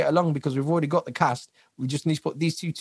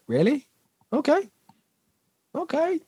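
surprised; 13 semitones higher than usual. Retold or imitated. Retold surprise at routine.

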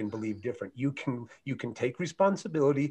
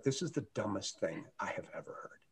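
breathy whispered inner aside, much softer; whispered thought; 3 semitones lower, 13 dB quieter than usual. Retold or imitated. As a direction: whisper it to yourself, unimpressed.

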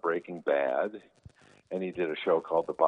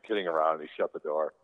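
usual speaking voice

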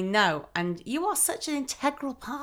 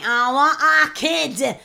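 mock fond; 6 semitones higher, 8 dB louder, much slower than usual. Mock reaction.

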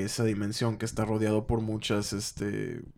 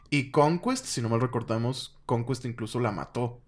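ordinary talk

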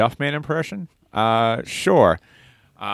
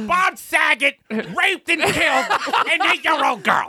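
much higher, slow, laughing squawk; Gilbert Gottfried voice; slower than usual. Pretend. Still doing Gilbert Gottfried for the group. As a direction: keep the Gottfried screech going. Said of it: "That is unbelievable".

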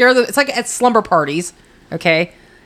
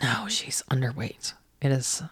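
whisper; 7 semitones lower, 13 dB quieter than usual. Real, not acted. Gossip aside.

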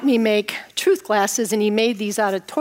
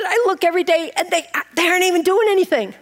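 whiny complaint; 7 semitones higher, faster than usual. Retold or imitated. Complaining about construction zone.

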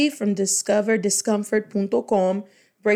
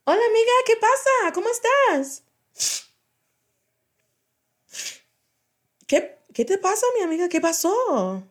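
put-on much higher, fast, girlish friend voice; high-pitched character voice; 12 semitones higher than usual. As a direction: squeak it out like a worried best friend. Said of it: "by changing my voice".

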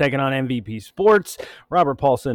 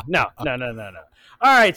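ordinary talk

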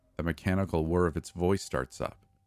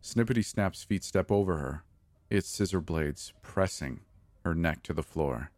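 plain reading voice

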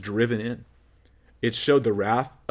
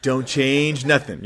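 flattering false prophet. Retold or imitated. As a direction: coax them gently, a hint of syrupy reassurance. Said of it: "They go".

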